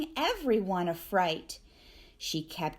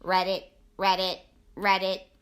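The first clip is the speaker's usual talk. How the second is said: low croaking frog voice; 4 semitones lower, 3 dB louder than usual. Acted. Frog croaking at the library, low and ribbity. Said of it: "and went".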